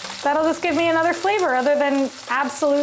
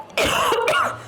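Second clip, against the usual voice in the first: high choking cough; coughing from chili fumes; 12 semitones higher than usual. Real, not acted. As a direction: cough on the spicy air. Chili in the air.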